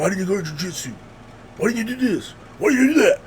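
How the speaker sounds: parroting everyone's nagging questions back; mocking questions. Mimicking people who ask why he trains, exasperated.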